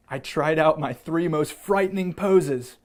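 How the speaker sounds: ordinary delivery, nothing marked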